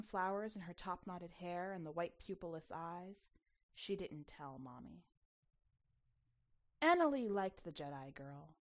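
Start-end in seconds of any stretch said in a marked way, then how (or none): none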